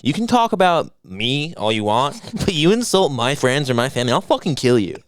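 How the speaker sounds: ordinary delivery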